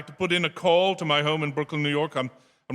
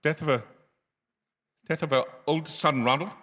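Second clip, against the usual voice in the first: ordinary talk, nothing marked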